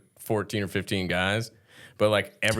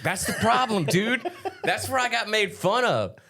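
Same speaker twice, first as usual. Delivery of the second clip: laughing; 13 semitones higher than usual. Real, not acted. Amused, recalling being mocked.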